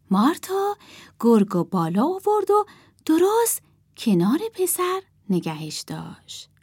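usual speaking voice

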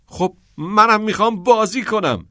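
high, loud burst of eagerness; bursting, high-pitched shout of joy; excited shout. A narrator's reading. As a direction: yell it out as he jumps on the bed. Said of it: "نیل فریاد زد".

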